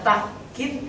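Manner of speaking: "takkid" cut by a catch after ta; reading with glottal stop. Shown as a demonstration. Teacher listing three valid readings, "takkid" with a stop. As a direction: say "takkid" as ta'-kid, stopping briefly in the middle. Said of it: "boleh dibaca takkid".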